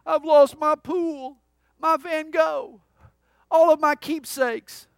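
high, loud, drawn-out wail; hammed-up tearful lament; mock sobbing. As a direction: wail it slowly like a heartbroken cartoon dog. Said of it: "he's sobbing".

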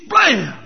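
sudden punchy "blam" of a gunshot; imitated gunshot. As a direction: mimic a rifle going off. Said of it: "And he shoots".

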